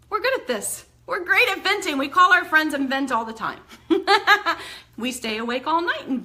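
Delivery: playful. Real, not acted. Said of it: "playful teasing about venting".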